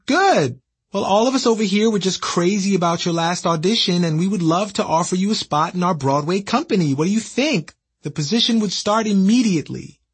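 high excited office voice; cheery caller. Retold or imitated.

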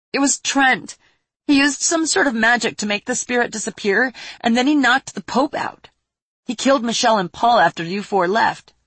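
high, loud tearful account; through sniffles. Acted. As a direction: tell it while fighting back tears. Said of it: "she said through sniffles".

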